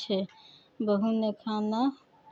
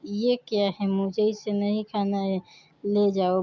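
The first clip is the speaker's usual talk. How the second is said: usual speaking voice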